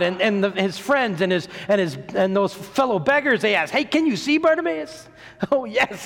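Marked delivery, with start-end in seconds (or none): 3.7-5.1 high-pitched, eager question; excited, high-voiced friend; excited asking. Friends excitedly ask healed man. 5.3-6.1 joyful. Acted. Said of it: "very joyful man".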